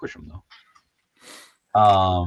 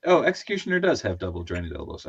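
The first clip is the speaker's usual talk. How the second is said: ordinary talk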